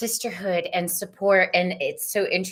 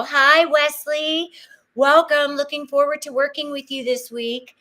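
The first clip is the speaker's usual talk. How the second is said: high, louder hello to a guest; bright excited greeting; 7 semitones higher, 5 dB louder than usual. Real, not acted. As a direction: welcome him with a burst of cheer. Host greets second guest.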